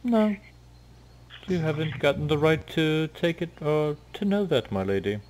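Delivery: high, coy teasing refusal; flirty Cher. Acted. Cher teases Maya about his name, coy. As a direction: tease the lady coyly. GM voices tiger.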